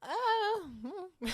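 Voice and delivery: high, wavering laugh-cry. Real, not acted. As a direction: wobble between laugh and tears. Half laughing, half crying.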